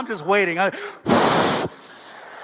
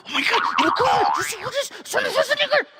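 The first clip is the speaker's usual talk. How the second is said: high, squealing fan freak-out; mock starstruck panic, pitched way up. Excited fan to his publicist on spotting Arnold, freaking out. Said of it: "and I freaked out".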